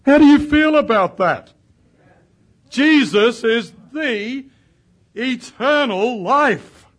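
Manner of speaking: high, loud, slow preacher's cry; full-voiced revival preaching; preaching at full force. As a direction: proclaim it to the whole congregation.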